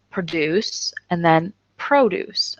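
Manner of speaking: "produce" pro-DUCE then "produce" PRO-duce, slowly; slow, careful stress shift; stress contrast demo. Shown as a demonstration. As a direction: stress the back, then the front syllable. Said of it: "So listen to one".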